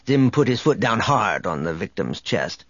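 usual speaking voice

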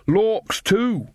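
high put-on exclamation; beggar act chime-in. A narrator's reading. Friend joins the beggar act.

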